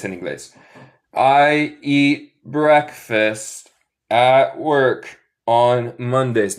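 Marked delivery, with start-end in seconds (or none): 1.1-6.6 very slow "I eat breakfast at work on Mondays", each word spaced; slow model sentence. Shown as a demonstration. Teacher models the sentence slowly again for students. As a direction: stretch it for repetition. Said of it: "Repiten después de mí".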